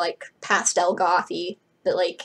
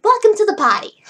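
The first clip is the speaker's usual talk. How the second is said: high, loud, hammed-up Australian welcome; terrible Australian accent; 12 semitones higher, 9 dB louder than usual. Blue welcomes Frankie to the group, in loud Australian accent. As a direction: holler it in a bad Australian accent. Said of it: "my terrible Australian accent".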